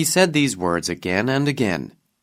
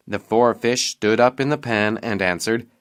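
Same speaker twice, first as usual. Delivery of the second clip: ordinary delivery, nothing marked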